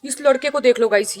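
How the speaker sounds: ordinary talk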